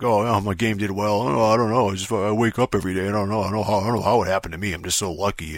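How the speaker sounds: a lightly voiced, sneering imitation of a humble-bragging creator; fake humble. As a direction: parody his false modesty, shrugging it off. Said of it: "like, fake, like, humble".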